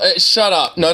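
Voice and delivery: ordinary talk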